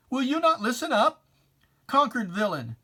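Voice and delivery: rising challenge to the villain; insistent demand. Acted. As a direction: press the question hard like a scold. Reciter demands the villain listen.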